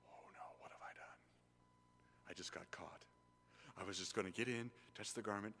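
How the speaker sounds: whispered panicked woman, very soft; the woman fearing she's been caught, whispering; frightened whisper. Acted. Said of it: "how do you think this woman feels".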